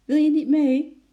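high, loud call to a friend. A narrator's reading. Girl calling to her pet to come along. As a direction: call after him brightly. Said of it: "riep Lotje hem toe".

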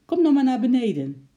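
high, loud urging call; nervous shout. A narrator's reading. Girl wants ape down from tree, nervously calling out. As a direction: urge him nervously from below. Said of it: "riep Lotje zenuwachtig".

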